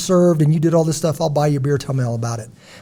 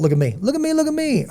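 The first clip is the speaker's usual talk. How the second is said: singsong look at me, last one much higher; mock a show-off begging for attention; 11 semitones higher than usual. Host mocking attention seekers, a high singsong taunt. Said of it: "We call it".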